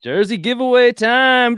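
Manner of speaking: high, slow hyped announcer call; playful high announcer sing-song. Pretend. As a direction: boom it out like a game-show host. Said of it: "I made up sound effects".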